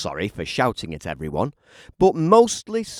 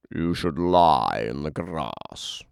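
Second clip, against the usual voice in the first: lightly voiced animal, a bit lower; 6 semitones lower than usual. Animals advise.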